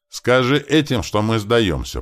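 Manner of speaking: high, loud call; shouting. Acted. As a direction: shout a message across to a friend. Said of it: "прокричал Туман".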